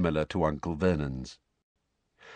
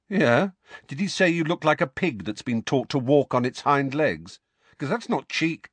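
high-pitched mocking challenge; scornful questions in a raised pitch; goading taunt; 7 semitones higher than usual. A narrator's reading. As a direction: wind your cousin up. Harry taunting Dudley.

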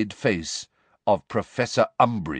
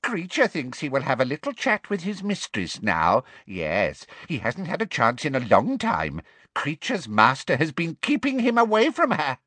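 higher elf voice, self-satisfied; gloating house elf; 5 semitones higher than usual. Acted. Elf turns from Harry to seek his mistress, gloating. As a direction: muse aloud as a sly servant elf.

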